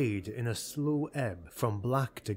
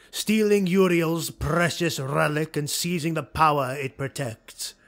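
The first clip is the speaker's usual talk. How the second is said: louder, higher, driving the plan; forceful lord; 4 semitones higher, 7 dB louder than usual. Acted. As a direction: press the point hard.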